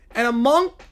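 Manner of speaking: normal talking voice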